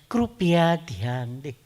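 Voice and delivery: slow, sweet mock air hostess voice; sweet announcement. Mimicking a flight attendant's sweet Hindi announcement. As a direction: coo it sweetly and slowly. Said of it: "એવું મીઠું મીઠું બોલે".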